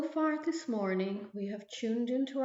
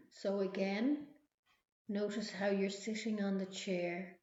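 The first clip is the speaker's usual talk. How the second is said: guided meditation. Acted. Leads a relaxation visualization.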